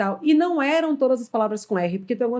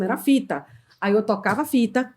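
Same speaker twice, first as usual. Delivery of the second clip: normal talking voice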